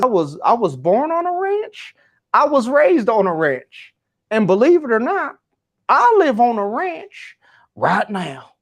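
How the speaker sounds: imitating a cowboy, high-pitched East Texan accent. Cowboy at the back cutting off the speaker, East Texan accent. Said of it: "rich East Texan accent".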